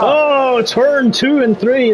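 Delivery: a hint of an Irish lilt on "turn two and three", drawn out slowly; lightly Irish "turn two and three", slow; slight Irish lilt; Irish accent. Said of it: "That almost sounded a bit Irish".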